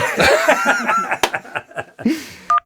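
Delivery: long high-pitched fit of laughter; high laughing fit. Real, not acted. Blooper laughter.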